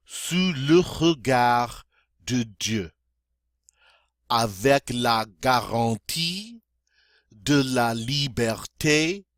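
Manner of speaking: slow, careful recitation of the pledge. Shown as a demonstration. Teacher reciting slowly for beginners. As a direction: read each phrase slowly for learners. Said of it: "a little faster now".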